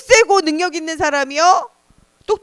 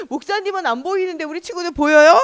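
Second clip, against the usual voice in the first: ordinary delivery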